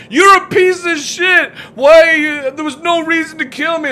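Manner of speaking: high, loud, indignant yell; mocking angry victim. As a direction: shout like an outraged victim. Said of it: "they're like".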